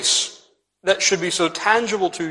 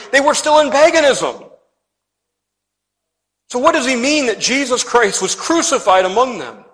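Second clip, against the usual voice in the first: raised, emphatic pulpit delivery; impassioned sermon exposition; forceful preaching; 4 semitones higher, 4 dB louder than usual. Acted. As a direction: preach it out with rising urgency.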